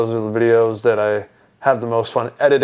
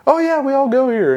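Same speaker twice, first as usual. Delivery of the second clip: imitated teasing girls; 13 semitones higher than usual. Girls pulling her leg, high imitated voice. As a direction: mimic the girls in a high put-on voice.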